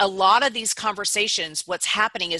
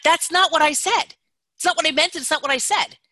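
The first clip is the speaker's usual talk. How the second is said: higher, louder exasperated protest; frustrated protest; 6 semitones higher, 4 dB louder than usual. Retold or imitated. Misunderstood person protesting to others, higher and louder. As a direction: insist you've been misunderstood. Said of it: "people are so frustrated with being misunderstood".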